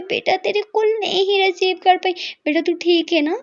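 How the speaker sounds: a mother relieved to finally hear her daughter; worried mother's voice. Acted.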